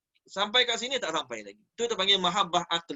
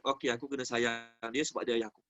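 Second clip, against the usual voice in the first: quiet self-persuasion; 7 semitones lower, 8 dB quieter than usual. Acted. Son reasoning to himself.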